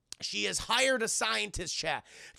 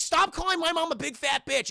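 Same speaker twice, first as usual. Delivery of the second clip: higher, louder yell of protest; raised-voice mock outrage; mock angry protest; 9 semitones higher, 5 dB louder than usual. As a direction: imitate an indignant kid yelling back. Acting out Kyle's angry protest.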